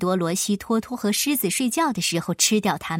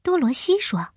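usual speaking voice

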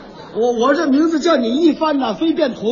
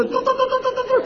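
high rolled doo-doo trill mocking fake Russian; cricket trill; 12 semitones higher, 4 dB quieter, faster than usual. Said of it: "你刚才那就是蛐蛐".

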